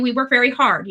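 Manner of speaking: ordinary talk, nothing marked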